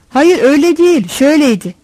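correcting objection. A narrator's reading. Assistant breaks into the driver's account, correcting him. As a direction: cut in to put the story straight. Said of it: "diye düzeltmeye kalkıyor".

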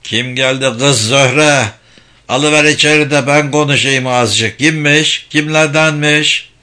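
put-on old man calling from another room; elderly man's insistent call. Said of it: "yaşlı bir erkek sesi duyuldu".

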